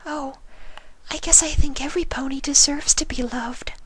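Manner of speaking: high, breathy whisper of a timid pony; gentle, shy, barely daring to ask; Fluttershy's voice. A narrator's reading. Fluttershy answers shyly.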